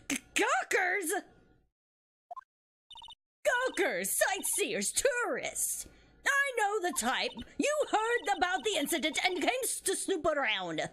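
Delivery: put-on high, indignant old woman rant; Old Bag. Guard accusing gawkers of snooping, indignant old woman voice. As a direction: rail at them like a suspicious old biddy. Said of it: "Anyways, this is Old Bag".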